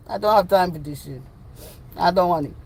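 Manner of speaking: mock African accent, dropping away. No time for this nonsense, African accent. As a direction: dismiss it in a put-on African accent. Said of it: "In my African voice".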